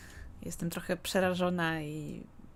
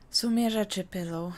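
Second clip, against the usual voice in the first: ordinary talk, nothing marked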